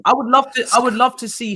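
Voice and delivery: high, loud, fired-up push; heated, high-pitched challenge to rival fans; excited emphasis. Real, not acted. Heated debate.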